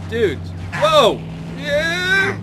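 loud, high excited whoops; excited shouts. Real, not acted. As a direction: whoop at the wild crash. Crash in game.

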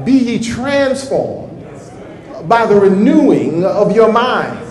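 slow, weighty reading of scripture; preacher quoting Romans slowly; slow scripture quote. Shown as a demonstration. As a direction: lean on each word of the verse.

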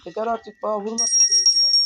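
loud, exasperated protest; fed-up yell. Real, not acted. Gamer yelling at Granny for hitting him, loud fed-up shout. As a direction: shout your frustration at the game.

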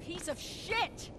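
cursing under the breath, high and very soft; slow, quiet swear to yourself in frustration; a drawn-out, hushed curse; frustrated curse. Acted.

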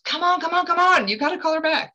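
high-pitched, urgent nagging; insistent pressure. Retold or imitated. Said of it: "it's almost like there's a pressure".

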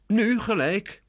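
high, loud urgent reply; eager. A narrator's reading. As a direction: jump right in. Lucy eager plan now. (gretig, hoog)

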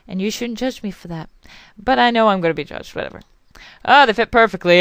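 usual speaking voice

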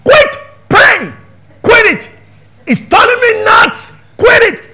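high, loud pleading yell; exasperated shout. Retold or imitated. Son yelling at praying mother to quit, exasperated shout. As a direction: beg her to stop, at full volume. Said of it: "He said".

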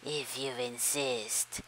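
a cool, slow predator; low slow mantis voice. Acted. Praying mantis accepts.

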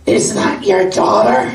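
loud, slow, breathy squawk; Marge-like lady blurting. Retold or imitated. Lady asks Jenny about the storyteller in a loud, squawky blurt. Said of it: "related, I think, to Marge Simpson".

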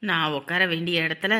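low, hushed grumble to oneself; resentful muttered aside. Acted. Relative grumbling about a lost seat, resentful mutter.

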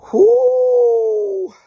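high loud celebratory whoo; a real whoop of delight; excited whoop. Real, not acted. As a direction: let out a big joyful holler. Celebrating win on show.

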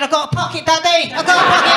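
put-on proud child saying it again; toddler voice. Toddler punchline repeating herself.

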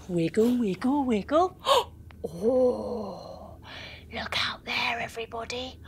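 hushed, awed whisper. Acted. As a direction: whisper as if to keep a secret. Looking out at the moon.

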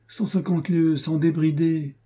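high-pitched plaint of a put-upon squire; mild sighing complaint. A narrator's reading. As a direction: moan lightly about the long ride. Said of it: "soupira l'écuyer".

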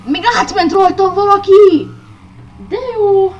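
loud, high mock-cheerful outburst; sarcastic angry yell. Real, not acted. Gamer run over by a rival yells sarcastically. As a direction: shout the sarcasm over the game.

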